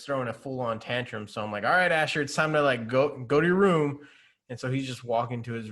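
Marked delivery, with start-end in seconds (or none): 1.6-4.0 a hint of a stern father, somewhat louder; firm dad. Dad sending tantruming Asher to his room, mildly stern. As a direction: set a firm limit, raised a little.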